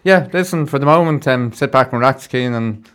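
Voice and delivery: bright presenter voice winding down the chat; radio host sign-off. Real, not acted. Radio host thanking his studio guest, upbeat presenter voice. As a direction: send the guest off like a DJ.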